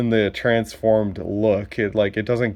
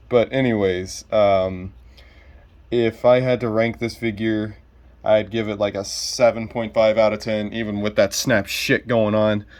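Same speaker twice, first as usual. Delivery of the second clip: ordinary talk, nothing marked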